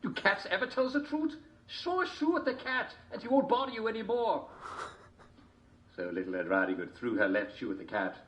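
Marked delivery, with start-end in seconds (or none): 0.0-4.5 high wolf voice. A narrator's reading.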